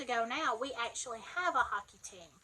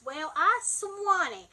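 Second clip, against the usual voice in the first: Alabama drawl; 5 semitones higher, 3 dB louder, slower than usual. Shown as a demonstration. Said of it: "here in Alabama".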